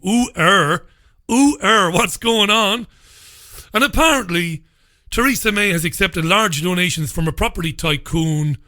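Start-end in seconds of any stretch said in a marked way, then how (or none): 0.0-2.9 raised, saucy "ooh, er" reaction; mock scandalised. Host reacts to a sex headline, mock scandalised. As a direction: feign shock at a bit of sleaze.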